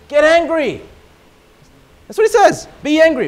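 preaching shout. Acted. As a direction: hammer the command home. Preacher driving home command.

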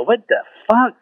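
high, puzzled swear at a phone; baffled outburst. Retold or imitated. Confused by his new iPhone, a high baffled swear. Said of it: "I look at it and go".

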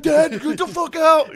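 high, loud, drawn-out shout; slow high-pitched holler; indignant teen yell. Acted. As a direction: scream at a parent to leave the room. Acted teen yelling at intruding dad.

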